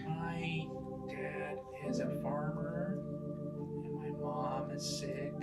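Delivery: put-on a little sad child; sad kid voice. Kid tells a sad story.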